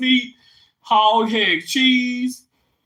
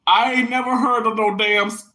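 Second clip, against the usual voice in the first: ordinary delivery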